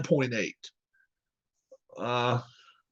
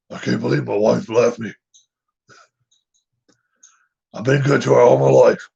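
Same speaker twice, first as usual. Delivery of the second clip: imitating Coach Orgeron; 4 semitones lower than usual. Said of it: "If I try to do his voice".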